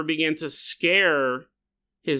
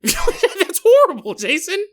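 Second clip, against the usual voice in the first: high, slow, laughing through the words; laughing mock scold; 12 semitones higher, 6 dB louder, slower than usual. Host mock-scolds himself for laughing, laughing high. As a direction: scold yourself while cracking up.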